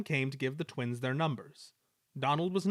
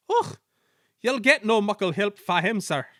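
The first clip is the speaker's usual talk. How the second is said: very high Och, broad Scots, louder; Scottish twins scoffing; Scottish twins telling the Controller in Scottish accent; 8 semitones higher, 9 dB louder than usual. A narrator's reading.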